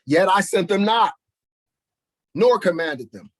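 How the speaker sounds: loud commanding scripture; forceful preaching. Real, not acted. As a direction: declare it with force.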